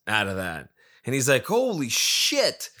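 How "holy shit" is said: drawn-out, much higher gasp of disbelief; amazed. Retold or imitated. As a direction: stretch it out, stunned at the win. Friend hits the jackpot.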